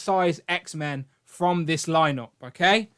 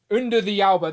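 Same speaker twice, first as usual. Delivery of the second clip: usual speaking voice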